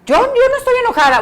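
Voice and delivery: grita negando que está enojada, más fuerte y agudo; negación enojada imitada. Retold or imitated. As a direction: niega el enojo gritando, cada vez más agudo. Madre niega enojo gritando. (mamá gritando que no está enojada, imitada)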